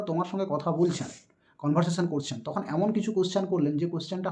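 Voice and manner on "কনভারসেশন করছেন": ordinary talk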